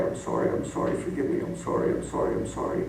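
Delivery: hushed desperate whisper; whispered apology. Retold or imitated. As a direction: whisper it, begging forgiveness. Son falls down apologizing.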